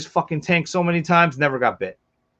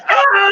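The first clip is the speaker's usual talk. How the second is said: sudden high-pitched yelp of panic; retold scream; 6 dB louder than usual. Handler with a biting snake, yelping in panic. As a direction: shriek like a scared little girl. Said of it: "I start screaming like a fucking five-year-old girl".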